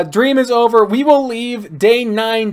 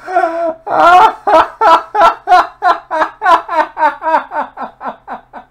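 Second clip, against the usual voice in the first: long high wheezing laugh; crying laugh; 10 semitones higher, 3 dB louder than usual. Real, not acted. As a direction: laugh till it hurts. Laughing at outfit.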